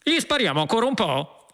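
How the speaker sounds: high-pitched cop calling to his partner; shout. A narrator's reading. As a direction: call across high to your mate. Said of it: "Un poliziotto gridò all'altro".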